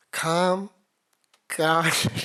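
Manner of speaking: each word called out big and separate; loud announcing. Real, not acted. Teacher introducing one program by name, loudly announcing each word.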